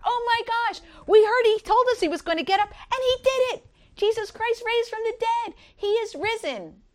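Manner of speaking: high, fast, breathless amazement; excited women. Retold or imitated. The women running from the tomb, thrilled. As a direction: gush like you can't believe the news. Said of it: "can you imagine how excited they were".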